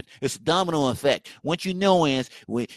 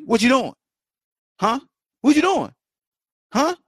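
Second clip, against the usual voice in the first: high, pushy challenge; mock heckling; 8 semitones higher than usual. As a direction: demand it like a heckler. Heckling someone for proof.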